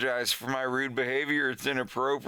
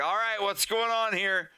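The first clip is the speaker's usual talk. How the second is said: higher, mock-stern teacher; a hint of a teacher stepping in; teacher breaking it up; 7 semitones higher than usual.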